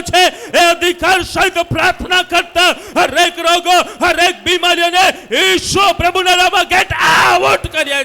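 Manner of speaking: fervent shouted prayer, pitched high; relentless, impassioned healing prayer; full-force preaching. Real, not acted. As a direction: command the sickness out with authority.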